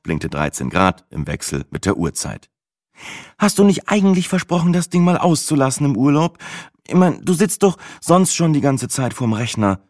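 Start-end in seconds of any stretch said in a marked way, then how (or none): 3.4-9.8 high, sing-song kindergarten-teacher voice. A narrator's reading. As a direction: talk down to him like a preschool teacher. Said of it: "in einem Ursula von der Leyen Kindergartentonfall".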